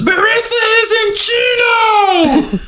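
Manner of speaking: very high, hammed-up scream. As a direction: scream it as if over a blender. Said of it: "and he screams".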